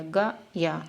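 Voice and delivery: गा and या said as two separate syllables with a gap; syllables apart. Shown as a demonstration. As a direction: split the word गया slowly into गा and या. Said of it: "ये जल्दी होता है ना". (गा और या अलग-अलग धीरे बोलना)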